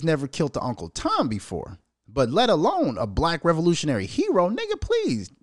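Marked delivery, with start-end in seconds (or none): 4.5-5.3 incredulous scoff. Real, not acted. Incredulous dismissal.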